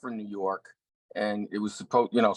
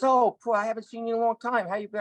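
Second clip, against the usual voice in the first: lightly voiced older friend, high and pleased; a hint of Joe greeting an old friend; Joe Pesci voice; 11 semitones higher than usual. Joe greeting Paul on set, warmly surprised. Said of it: "So he says".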